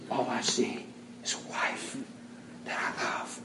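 whispered, soft and sincere; tender whisper. Retold or imitated. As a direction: breathe it like a confession. Husband's tender whispered confession.

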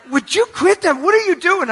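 high, loud imitated complaint; mock exasperation. Acts out friend after sneak attacks.

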